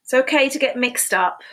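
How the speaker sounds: bright, high storybook read; sing-song read-aloud for little kids; reading to children. A narrator's reading. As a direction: read it like a cheerful picture book. Reading picture book aloud.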